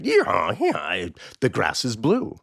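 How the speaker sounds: mildly acted stubborn insistence; insistent, stubborn. Donkey arguing with the tiger over the grass, stubbornly insistent. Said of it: "donkey is insistent, just as stubborn".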